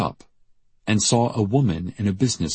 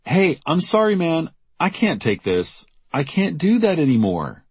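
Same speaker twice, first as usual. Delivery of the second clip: high, pleading refusal; overwhelmed shopkeeper Jackie; 10 semitones higher than usual. Acted. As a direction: beg off in a raised pitch, at wit's end.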